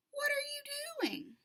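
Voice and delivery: put-on a child whispering a question; whispered child's question. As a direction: whisper it, high and curious. Child asks teacher.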